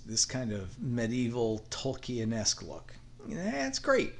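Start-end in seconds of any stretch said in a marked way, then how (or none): 3.3-4.1 mock enthusiasm. Real, not acted. Dismissing a popular building trend.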